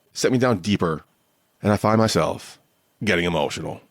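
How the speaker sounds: ordinary talk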